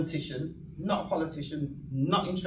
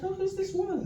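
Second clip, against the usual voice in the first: high, snooty mock voice; high mock sniff of a question; mocking quote. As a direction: play the sneering onlooker asking who she is. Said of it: "somebody said".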